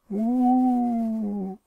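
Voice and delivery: long, high ghostly "wooo"; sustained high spooky howl; spooky cave wail. A narrator's reading. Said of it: "cried the deep, dark, mysterious cave, read Brother with a lot of expression".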